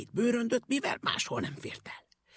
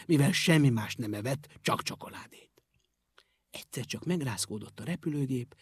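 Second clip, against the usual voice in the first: usual speaking voice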